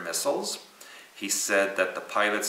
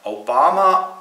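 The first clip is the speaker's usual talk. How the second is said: mock Korean accent; 7 semitones higher, 11 dB louder, slower than usual. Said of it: "say it in Korean accent".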